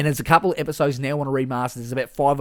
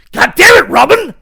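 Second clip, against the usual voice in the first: much higher, much louder angry yell; furious Batman; 17 dB louder than usual. Retold or imitated. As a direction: explode at Robin in a sudden rage. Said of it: "when something pisses him off".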